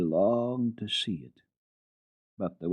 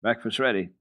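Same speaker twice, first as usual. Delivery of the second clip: said shortly. Acted. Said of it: "said shortly".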